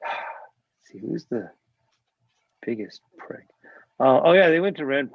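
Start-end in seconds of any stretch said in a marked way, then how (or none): 0.0-3.5 breathy, near-whispered aside to yourself; muttered aside. Real, not acted. Speaker half-whispering to herself while recalling a name. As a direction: drop to a private mutter while you think.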